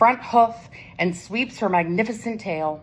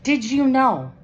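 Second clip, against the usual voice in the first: louder and higher question; 7 semitones higher, 5 dB louder than usual. A narrator's reading. Unicorn repeats louder so Kitty hears. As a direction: say it up so she hears. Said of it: "says Unicorn".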